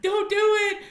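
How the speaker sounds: high, loud, desperate begging; pleading hero. Retold or imitated. Christopher Robin begging Pooh to take him instead, high desperate plea. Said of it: "pleading with Winnie the Pooh".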